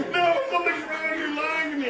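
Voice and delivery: high-pitched panicked protest; frantic disbelief. Retold or imitated. Wife doubting husband about newborn, frantic.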